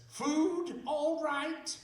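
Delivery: high, slow, sinister sing-song offer; imitating Patrick McGee. McGee's writer offering Alex drugged wine, slow and high. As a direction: draw out the offer slyly, far above your own voice. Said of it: "And then he says".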